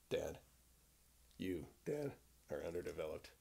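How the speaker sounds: low, quiet voice for the dog; lightly put-on grumpy dog voice talking back; voicing the dog. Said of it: "A female dog with a male voice".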